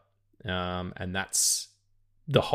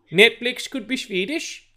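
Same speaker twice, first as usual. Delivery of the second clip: much higher, slow mock Swedish Netflix voice; mock Swedish accent; 14 semitones higher, 7 dB louder than usual. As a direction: repeat it in the same silly high Swedish accent, slowly. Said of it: "That wasn't a Swedish accent".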